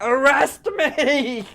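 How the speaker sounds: high, loud, drawn-out cry; panicked plea. Acted. Fleeing robber begging the police for protection, panicked cry. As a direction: beg in hammed-up terror.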